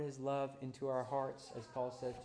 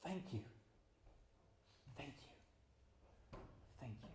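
fading whispered thanks; 14 dB quieter, slower than usual. Acted. Prayer fading after loud climax.

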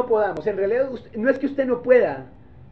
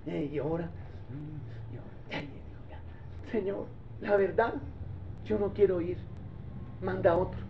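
much softer, hesitant mumbling; reluctant Moses; 4 semitones lower, 11 dB quieter than usual. Acted. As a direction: squirm out of it with sighs. Moses making excuses to God.

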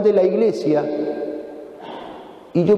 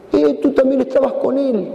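higher, pointed accusation; accusing servant; 9 semitones higher than usual. Acted. Servant girl accusing Peter by the fire, higher. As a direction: catch him out with a high jab.

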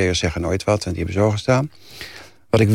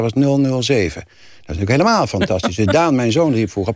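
ordinary delivery

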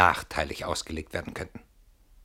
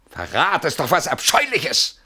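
empört laut; 7 semitones higher, 5 dB louder, slower than usual. Acted. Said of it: "du schreist ja".

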